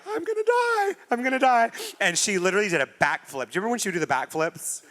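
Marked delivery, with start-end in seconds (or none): 0.1-1.7 squeaked high, panicked I'm gonna die; hammed-up dread, pitch shooting up; mock panic. After pulling off Paris's wig, high panicked I'm gonna die. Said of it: "and I was like".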